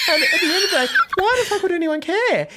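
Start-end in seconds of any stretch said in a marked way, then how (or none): 1.2-2.5 incredulous laughing outcry; exasperated outburst. Real, not acted. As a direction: throw up your hands at your own taste. Exasperated about caring.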